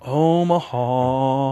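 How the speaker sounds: "Omaha" stretched very slowly; drawn-out echo. Pretend. Host savoring the town's name Omaha, long drawn out. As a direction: linger on every vowel of "Omaha".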